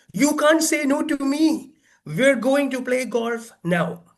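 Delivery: high, insistent command; bossy Hawking. Retold or imitated. Hawking ordering his friend to golf, high and insistent. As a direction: lay down the law, mock-bossy.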